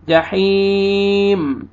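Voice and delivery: "जहीम" with a very long ee; slow chanted "जहीम", vowel held long; stretched madd. Shown as a demonstration. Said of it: "अदा करके खींचना है".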